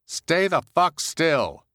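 muffled shout through steel door; barked command from outside the truck; through a wall. Acted. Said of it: "commanded Heidi, her voice muffled".

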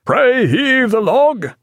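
very high, slow, carrying command; calling out an order. A narrator's reading. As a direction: sing the order out across the deck. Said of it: "called Jack".

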